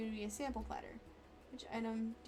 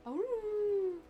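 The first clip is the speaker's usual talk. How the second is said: excited; 9 semitones higher, 5 dB louder than usual. Real, not acted. Excited reaction.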